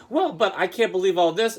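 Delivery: lightly voiced sassy student; sassy imitation. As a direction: play up her huffy sass. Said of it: "she's kinda sassy".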